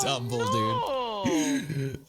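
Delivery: very high, slow wailing shout; anguished cry. Real, not acted. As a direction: cry out in dismay. Crying out at game disaster.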